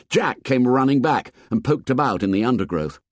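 ordinary delivery, nothing marked

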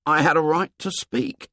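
put-on high, matter-of-fact boy; high-pitched, unadorned statement; said simply. A narrator's reading. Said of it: "said Piggy simply".

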